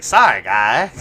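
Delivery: mock polite Canadian apology; Canadian accent. Killer apologizing to victims in Canadian accent. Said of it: "sound like a South Park character".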